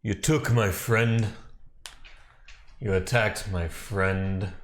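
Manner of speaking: mild in-character shout, spaced out for weight; restrained shout. Acted. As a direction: call out an accusation, deliberate and controlled. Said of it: "I just kinda shout".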